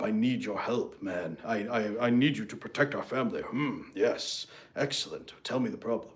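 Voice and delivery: puffed-up husband rising to it, lightly mocked; manly husband. Husband hearing his wife ask for help, mock manly. Husband voiced after testosterone remark.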